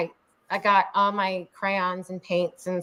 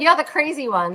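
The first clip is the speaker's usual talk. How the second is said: high, loud playful "you're the crazy one"; excited high quote; 8 semitones higher, 5 dB louder than usual. Retold or imitated. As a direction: retell the jab with high excited glee. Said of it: "she said".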